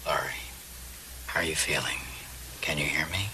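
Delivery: soft unvoiced whisper. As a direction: whisper it gently at the bedside. Father checks on unconscious daughter.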